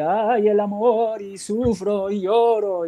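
sing-song a mock flamenco heartbreak; slow, drawn-out parody of a flamenco love song; mock flamenco lament. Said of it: "en España las flamencas de".

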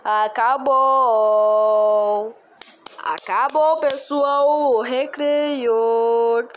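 calling out to class. Pretend. Teacher calls end of recess.

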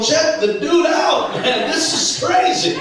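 amused Jesus. Retold or imitated. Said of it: "Jesus was looking over the balcony of heaven going".

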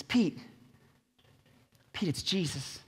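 quiet nudge to his friend; hushed urgent aside. Retold or imitated. John quietly tells Peter.

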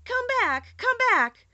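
high, urgent call. A narrator's reading. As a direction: call them back, voice leaping up. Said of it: "while he called to the mice".

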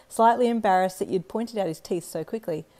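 plain reading voice

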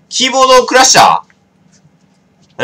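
slow, stretched and loud, like a dramatic reveal; drawn-out mock drama. Real, not acted. To the stream about the keyboard, a slow theatrical announcement. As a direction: savour the word as if announcing a twist.

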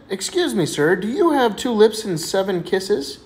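lightly voiced lady, high and slow; elderly lady caller.

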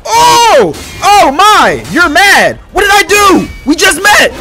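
high, loud cries of protest; outraged, high-pitched yelling; indignant shouting. Real, not acted. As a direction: yell in shocked protest at an attacker. Shouting at enemy that attacked him.

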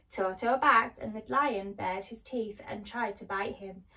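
plain reading voice

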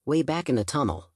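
usual speaking voice

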